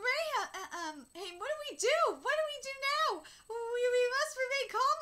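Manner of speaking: high, loud, flustered alarm; acted panic. Subjects asking their king what to do, acted panic. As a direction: blurt it out in hammed-up panic.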